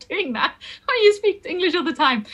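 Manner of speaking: giggling while speaking, high; laughing at the sound; embarrassed giggle. Real, not acted. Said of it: "It feels really weird".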